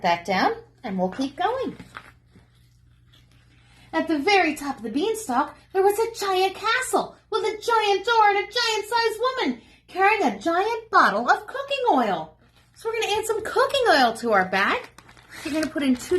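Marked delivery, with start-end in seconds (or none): 3.9-12.4 story narration. A narrator's reading. Reads story to children.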